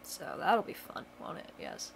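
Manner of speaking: normal talking voice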